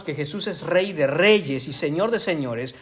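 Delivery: ordinary talk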